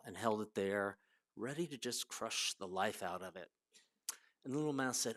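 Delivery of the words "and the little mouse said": ordinary talk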